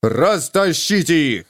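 slow, barked command; a slow, forceful bark to break up the fight; barked order. A narrator's reading. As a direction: bark at the men to pull them apart. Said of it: "рявкнул первым пришедший в себя".

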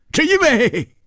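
very high, loud furious roar; explosive burst of fury. A narrator's reading. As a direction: bellow it at the top of the range. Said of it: "他大吼着".

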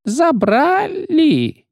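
high, very slow pained wail; clerk in agony. Acted. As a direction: stretch out the word in anguish. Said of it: "словно в пароксизме боли".

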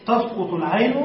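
ordinary talk, nothing marked

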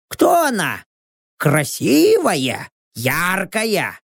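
Rox. A narrator's reading. Rox asks about the girl in the photo, curious character voice. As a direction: question eagerly in a character voice.

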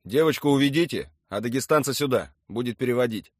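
loud, firm military order; commanding. A narrator's reading. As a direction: order your men about briskly. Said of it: "скомандовал Юрий Николаевич".

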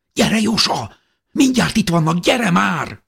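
high, loud panicked urging; girl urging her sister to hide; urgent frightened call. A narrator's reading. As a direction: hurry her under the bed. Sister urges hiding under bed.

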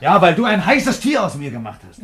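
a bitter furious accusation; angry outburst. Acted. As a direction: hurl the blame at her.

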